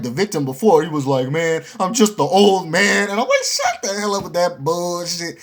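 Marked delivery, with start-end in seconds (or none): 1.3-3.0 mock pitiful old man. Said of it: "trying to act like he's all sad". 3.4-5.4 mock angry retort. Retold angry retort to teacher.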